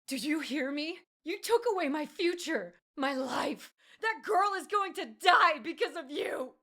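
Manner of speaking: high, loud screaming accusation; continued screaming. Acted. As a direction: keep screaming at him as she thrashes. Elf keeps screaming while restrained.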